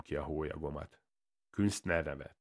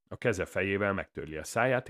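plain reading voice

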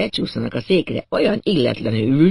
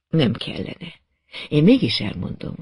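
usual speaking voice

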